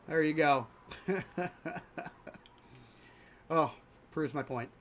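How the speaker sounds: ordinary talk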